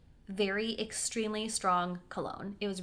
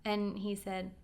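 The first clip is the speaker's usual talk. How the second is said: normal talking voice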